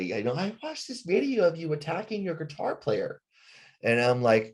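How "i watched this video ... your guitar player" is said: higher, prim accusing mock voice; mocking accusers. Fans quoting the viral video, lightly higher mock accusers. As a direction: voice the online critics higher and snippy. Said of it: "all these people say".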